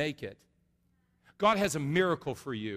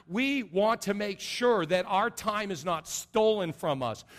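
loud, slow, forceful sermon delivery; preaching at full force; 5 semitones higher, 5 dB louder than usual. Acted. Pastor to congregation, forceful preaching. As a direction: drive each command home.

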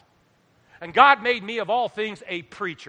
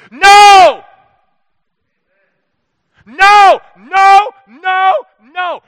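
full-voiced, much higher and louder shouts of no; screamed no; 13 semitones higher, 22 dB louder, much slower than usual. Shown as a demonstration. Preacher demonstrates screaming no at the devil, full-throated shout. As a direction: scream it at the devil, each no a blow. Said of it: "you need to scream no to godlessness".

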